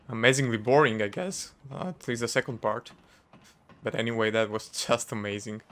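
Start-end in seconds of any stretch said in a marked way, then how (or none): none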